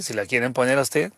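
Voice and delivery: ordinary delivery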